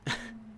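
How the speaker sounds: laugh. Real, not acted. Player laughing.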